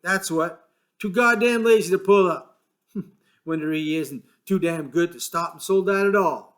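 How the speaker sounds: bitter grumble about the bus driver; angry. A narrator's reading. George cursing the bus driver, angry. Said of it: "He said angrily".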